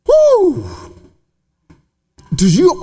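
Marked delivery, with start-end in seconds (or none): 0.1-0.7 excited whoop. Real, not acted. Preacher exclaims at climax.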